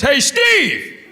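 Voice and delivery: high, loud call across a cinema; yelling out. Retold or imitated. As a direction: yell the name to a stranger. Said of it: "Another guy goes".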